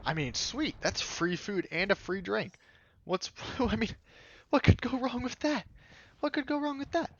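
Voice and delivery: mock giddy, high voice; gleeful. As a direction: bounce up high like you just won something. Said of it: "And I'm just like".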